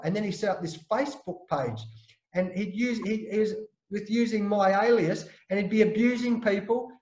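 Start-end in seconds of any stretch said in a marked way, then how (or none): none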